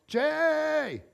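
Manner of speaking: high drawn-out yell; retold yell. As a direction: imitate a distressed call across the water. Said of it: "and she yelled".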